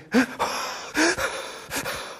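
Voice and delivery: breathless, voiceless catching gasps; a demo of catch-your-breath sobbing gasps. Shown as a demonstration. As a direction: gulp air in short bursts like someone sobbing. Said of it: "anybody sobbing, that's how it sounds".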